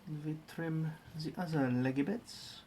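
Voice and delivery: narrating each step in a hammed-up German accent; mock German accent with z for th; bad German accent. Streamer narrating his Lego build in a mock German accent. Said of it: "In a German accent, apparently".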